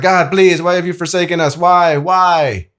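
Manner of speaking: crying out. Retold or imitated. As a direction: cry out to heaven, begging. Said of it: "they're crying out to".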